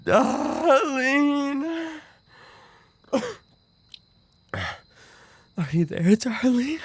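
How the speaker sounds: high, crying whisper; tearful plea. Acted. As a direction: beg them not to leave, weeping. Calling for lost darling.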